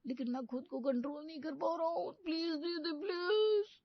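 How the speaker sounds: high, whiny begging; pleading. Acted. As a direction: beg her like a child. Groom begging sister.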